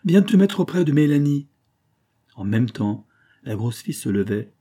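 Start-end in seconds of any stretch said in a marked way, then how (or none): 0.0-1.5 sharp command. A narrator's reading. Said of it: "s'écria enfin Victoire".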